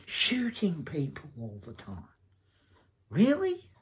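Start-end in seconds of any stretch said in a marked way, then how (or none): 3.1-3.7 high, loud scoffing "really?"; incredulous. Real, not acted. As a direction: scoff in disbelief, pitch jumping up. Scoffing at the Penguin actor.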